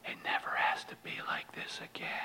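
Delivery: hushed, voiceless whisper; whisper in the ear. Retold or imitated. Friend hugging a newcomer at his first meeting, whisper. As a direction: whisper it close, as a private reassurance. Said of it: "he whispers in my ear".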